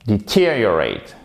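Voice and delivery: "deteriorate" as di-TEER-ee-uh-rate; stress on TER. Shown as a demonstration. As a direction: punch the second syllable of "deteriorate", falling after. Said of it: "vous mettez l'accent tonique ici".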